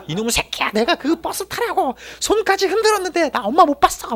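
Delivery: very high-pitched mock tirade; imitated cursing mother. Old-fashioned mother cursing her lost son at the police station, mock-furious. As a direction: act out mum's furious scolding, pitch way up. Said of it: "쌍욕부터 하셨어요".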